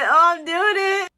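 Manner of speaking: high, loud, drawn-out giving in; giddy surrender. Real, not acted. Caving and reading the book's last sentence, giddy. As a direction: gleefully give in to temptation.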